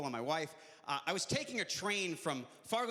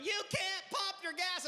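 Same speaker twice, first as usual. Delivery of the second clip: much higher, slow mock yell; mock screaming attendant; 10 semitones higher, slower than usual. Gas station attendant yelling at the comic, slow high mock yell. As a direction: stretch it out like a panicked attendant. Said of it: "screaming out of the gas station going".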